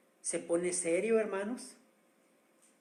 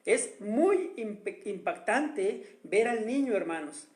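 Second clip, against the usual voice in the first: ordinary delivery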